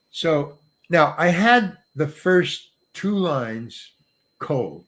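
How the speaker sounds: ordinary talk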